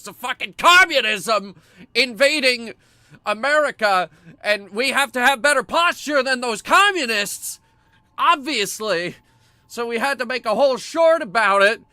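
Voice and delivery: high, loud mock-alarmed rant; loud hammed-up indignation. Mock rant about communists.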